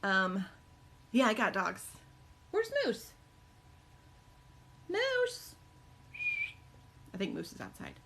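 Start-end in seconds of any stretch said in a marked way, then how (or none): 2.5-5.5 very high sing-song call; calling the dog. Real, not acted.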